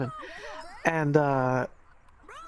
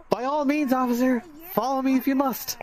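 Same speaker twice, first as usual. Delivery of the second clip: higher, theatrically obliging retort; mock-gracious; 6 semitones higher than usual. Bike rider lets suspicious trooper follow him, mock-gracious. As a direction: grant permission with exaggerated courtesy. Grandly invites officer to follow.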